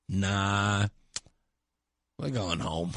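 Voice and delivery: low, soft, bored reply; drawled dismissal; lazy mock protester. Protester lazily telling reporter they're going home.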